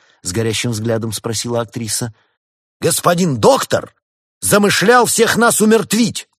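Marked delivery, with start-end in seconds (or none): 2.8-6.3 loud, high, slow indictment; measured, ringing charge; prosecutor's accusation. A narrator's reading. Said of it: "тоном прокурора обвинил делец".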